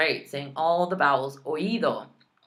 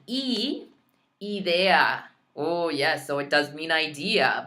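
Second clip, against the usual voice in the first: ordinary talk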